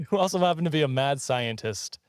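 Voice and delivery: normal talking voice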